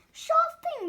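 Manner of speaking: high and loud, eager. Acted. Arnie eager, acted voice.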